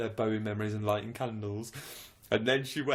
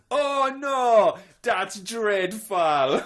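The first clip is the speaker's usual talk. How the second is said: high, loud, very slow mock posh voice; hammed-up posh horror; posh lady; 11 semitones higher, 10 dB louder, much slower than usual. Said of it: "and she went".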